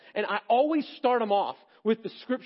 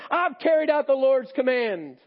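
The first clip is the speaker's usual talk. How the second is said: much higher and louder, eager and beaming; excited greeting; 6 semitones higher, 6 dB louder than usual. Acted. Saul hailing Samuel proudly, excited and high. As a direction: greet him like you've done everything right. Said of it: "He's excited".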